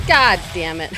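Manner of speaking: loud outburst of frustration; exasperated curse. Real, not acted. Gamer killed by a mine, exasperated curse. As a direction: curse loudly at sudden bad luck.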